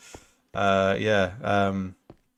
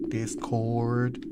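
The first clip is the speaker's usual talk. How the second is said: put-on hillbilly accent. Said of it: "like, a hillbilly accent".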